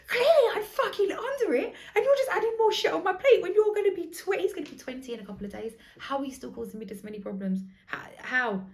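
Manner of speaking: high, loud, laughing mock rant; exasperated outburst. Mum reenacting how she vented at her son, high and loud. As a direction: let it all pour out at him, half laughing. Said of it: "I'm like".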